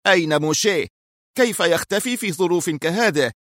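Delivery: high-pitched, exasperated demand; furious. A narrator's reading. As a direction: exclaim it in irritated rage. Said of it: "وهتف في حنق".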